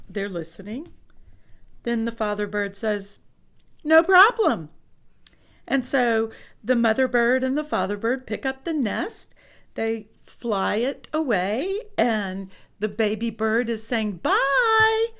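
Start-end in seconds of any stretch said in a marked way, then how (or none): 3.8-4.7 higher, louder chirpy reply; father bird agreeing to move the nest. Acted. 14.2-15.1 very high little peep; baby bird saying goodbye. Acted. Baby bird says bye.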